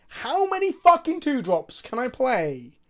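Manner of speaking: loud, high, slow exasperated complaint; frustrated outburst. Real, not acted. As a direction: vent at the deck. Frustrated at draws.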